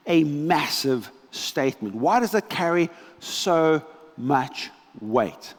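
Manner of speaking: normal talking voice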